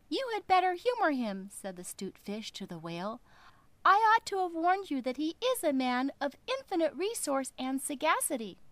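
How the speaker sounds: high, quick, cunning little voice; little fish voice. Acted. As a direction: rattle it off like a sly tiny fish.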